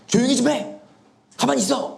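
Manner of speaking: high, emphatic acted rebuke; stern crowd member telling someone off; forceful scolding. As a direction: order the noisy beggar to hush. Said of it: "강하게".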